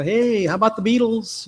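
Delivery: high, goofy voice, imitating himself trying to fit in; a lightly hammed-up eager student; mock eager chatter. Mock eager small talk.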